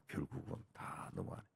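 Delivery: hushed knowing echo; whispered echo. Real, not acted.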